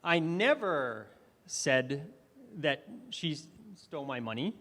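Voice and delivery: "never" stretched out slowly with a pitch swell; stress on NEVER. Shown as a demonstration. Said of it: "I emphasized different words".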